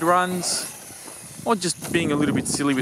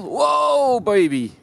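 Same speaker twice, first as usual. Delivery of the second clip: surprised shout; 7 semitones higher, much slower than usual. Real, not acted. Car crashes hard on jump.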